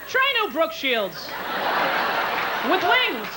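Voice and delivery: breezy commercial pitch; a spoof sanitary pad ad read; mock ad voice. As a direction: sell it like a TV ad.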